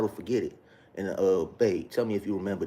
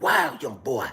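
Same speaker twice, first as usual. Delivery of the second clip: put-on a touch higher, slightly louder old pastor; imitating father-in-law; 4 semitones higher, 4 dB louder, slower than usual. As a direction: lightly mimic the pastor. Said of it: "This is how her father talked".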